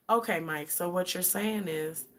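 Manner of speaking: hushed, low customer-service calm. Acted. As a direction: go very soft and measured. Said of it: "the lower I go".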